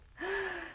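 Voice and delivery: sharp startled gasp; a quick shocked intake of breath. Acted. Disciples react to the news.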